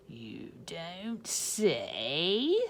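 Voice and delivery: very slow, dry witch voice; dry reply. Acted. Swamp mocking the principal, very slow. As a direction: drag it out with dry sarcasm.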